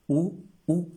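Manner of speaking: "U, U" said high as French oo. Shown as a demonstration. Teacher modelling French où. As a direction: sing out "U, U" like où. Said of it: "se pronuncia igual".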